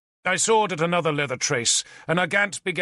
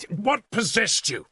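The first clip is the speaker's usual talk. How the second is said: sharp, slowed rebuke; shouting at him; 7 semitones higher than usual. A narrator's reading. Lord rebukes a late captain, raised voice. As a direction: demand an answer from the late commander. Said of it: "I shouted at him".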